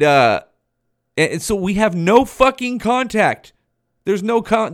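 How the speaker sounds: normal talking voice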